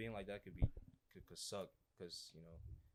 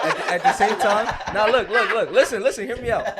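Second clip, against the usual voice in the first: loud and much higher, laughing through it; laughing protest; 11 semitones higher, 22 dB louder than usual. Real, not acted. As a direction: shout over the laughter to be heard. Defending himself while friends laugh.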